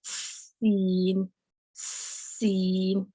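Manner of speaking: سین drawn out on a long hiss; whistling s demo. Shown as a demonstration. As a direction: lead سین in with a whistle sound. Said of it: "پہلے سیٹی کی آواز لے کے آنی ہے". (سین کو سیٹی کی آواز سے شروع کریں)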